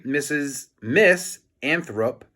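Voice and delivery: ordinary delivery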